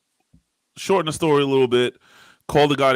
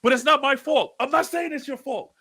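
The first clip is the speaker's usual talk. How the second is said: high, pitched-up venting, half laughing at himself; retold angry yelling; 13 semitones higher than usual. Husband tells wife he's yelling at the fridge, high retold rant. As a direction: replay the rant at the fridge, higher. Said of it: "I'm like".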